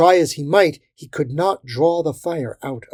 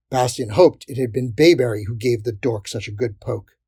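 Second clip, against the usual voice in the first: usual speaking voice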